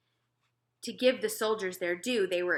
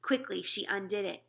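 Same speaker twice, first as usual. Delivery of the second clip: plain reading voice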